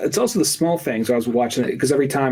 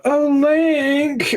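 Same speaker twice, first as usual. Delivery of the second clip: swooning princess call; 12 semitones higher than usual. Retold or imitated. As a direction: call out to Link like a lovesick princess. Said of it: "leans out".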